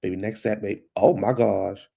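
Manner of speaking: usual speaking voice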